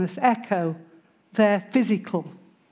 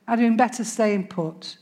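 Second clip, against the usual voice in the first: ordinary delivery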